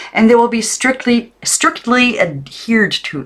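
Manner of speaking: high, loud, slowing on strictly; strict aunt. A narrator's reading. Aunt Lillian warning Maggie, slow and stern. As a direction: drag out the strictness. Aunt voiced in read story.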